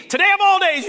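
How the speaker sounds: sudden high, loud exasperation; half-laughing exasperated cry; exasperated outburst. Retold or imitated. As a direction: blurt it in mock disbelief.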